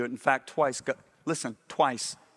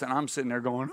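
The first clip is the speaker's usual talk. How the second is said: normal talking voice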